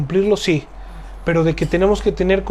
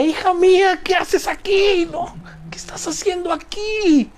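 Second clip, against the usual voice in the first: much higher, breathless whispered dismay; stunned anguish; 13 semitones higher than usual. Shown as a demonstration. Father seeing his daughter run out, whispered horror. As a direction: gasp it out in horrified disbelief. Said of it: "yo diría".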